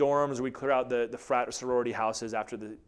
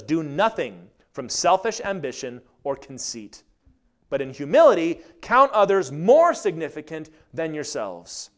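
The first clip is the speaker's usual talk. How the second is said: scripture reading; 4 semitones higher, 8 dB louder than usual. Acted. Reads scripture.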